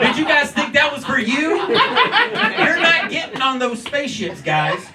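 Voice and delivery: mock outrage at the audience; shouted rant. Real, not acted. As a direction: yell it at the crowd. Ranting at audience.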